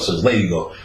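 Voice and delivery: normal talking voice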